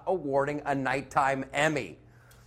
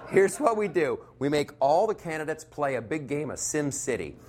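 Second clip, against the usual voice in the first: normal talking voice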